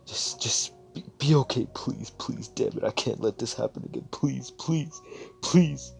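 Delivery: rising, breaking cries of please; frantic begging, cracking up to a cry; desperate pleading. Acted. Desperate begging over dying brother.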